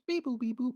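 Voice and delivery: high, soft beep-boop noises; imitated ringing in the ear. Retelling being called names, mimicked ringing. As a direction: imitate ears ringing after a shock. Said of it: "that ringing in my ear".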